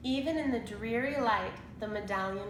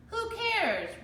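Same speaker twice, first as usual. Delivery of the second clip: much higher pirate roar; 13 semitones higher, 3 dB louder than usual. A narrator's reading. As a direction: roar it dismissively at the kids. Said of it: "roared Captain Bones".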